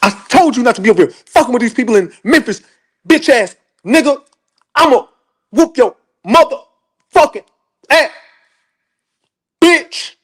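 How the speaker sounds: high, fast scolding mother voice; angry mama. Retold or imitated. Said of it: "every swing came with a different fucking word".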